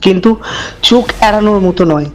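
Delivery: low, hushed storyteller voice; eerie narration, measured and dark; horror story narration. A narrator's reading. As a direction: draw the listener into a creepy tale. Horror story narration before intro.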